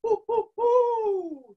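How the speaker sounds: high, slow hooting hoo; owl hoot. Shown as a demonstration. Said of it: "hoot like this".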